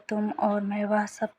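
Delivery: ordinary talk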